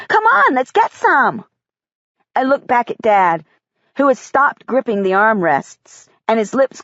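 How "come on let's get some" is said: put-on eager little boy. Michael voiced as child.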